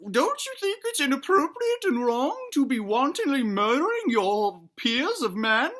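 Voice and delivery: preachy put-on granny voice; scolding granny. Granny questions the boy's love of killing games, scolding. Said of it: "And Granny says".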